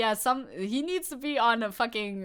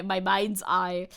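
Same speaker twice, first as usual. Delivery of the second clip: ordinary delivery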